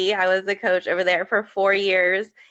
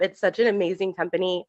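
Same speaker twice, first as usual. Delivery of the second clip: ordinary delivery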